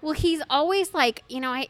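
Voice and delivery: ordinary talk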